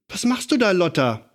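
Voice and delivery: a lightly voiced old aunt, alarmed; calling out. A narrator's reading. Aunt Berg leaning out the window calls to Lotta. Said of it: "rief sie".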